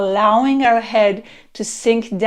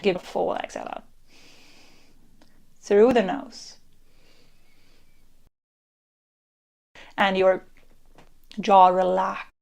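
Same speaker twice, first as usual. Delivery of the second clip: guided meditation; 2 semitones lower than usual. Acted. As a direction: lull them into relaxing.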